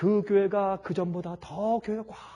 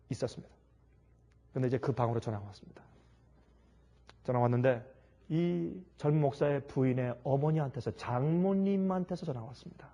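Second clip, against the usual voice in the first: ordinary talk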